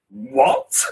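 high, shocked yelp of a question; stunned what. Retold or imitated. Medium retelling hearing of a sister he never knew, shocked. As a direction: hit it with utter disbelief.

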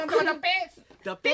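put-on high, loud Ibaraki-accent parody; mock Ibaraki dialect. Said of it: "方言なんじゃないの". (茨城弁の甲高い真似)

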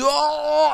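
a sharp high imitated cry; mock yelp of pain. Retold thief crying out over his lost tooth, a high mock yelp. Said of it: "En hij roept".

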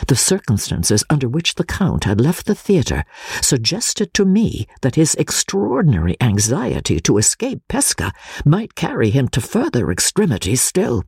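plain reading voice

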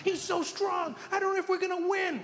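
high worried hero voice. Acted.

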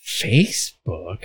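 Facebook drawn out very slow, low and soft; very slow puzzled. Retold or imitated. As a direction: stretch Facebook out slowly and quietly. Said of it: "I was like".

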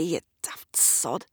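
soft whispered fond insult; whispered affectionate jibe; whispered scold. Acted. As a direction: breathe the insult fondly. Eric affectionately scolds Gavin.

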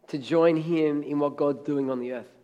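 normal talking voice

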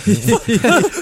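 high nervous giggle; a jittery little laugh. Real, not acted. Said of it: "that's [name]'s nervous laugh".

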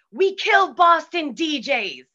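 mock menacing shout from the crowd; heckler yell. Chicago crowd member threatening the Boston DJ, loud yell. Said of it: "somebody in the crowd just yells".

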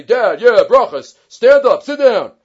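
loud, mock-gruff orders. Son faking his brother's manner to his father, loud and gruff. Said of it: "he's gonna try to be gruff".

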